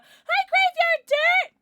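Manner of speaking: high, drawn-out shout of greeting; shouted hello. Real, not acted. Streamer has everyone greet a chatter, shouted hello. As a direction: holler hello slowly and very high.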